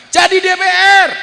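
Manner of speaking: high loud shout; fiery preacher shout; preaching shout. Real, not acted. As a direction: belt it to the crowd.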